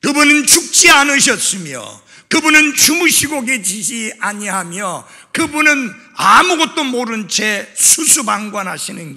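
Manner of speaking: high, shouted declaration; impassioned loud sermon cadence; full-force preaching. Real, not acted. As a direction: proclaim each clause at the top of the voice.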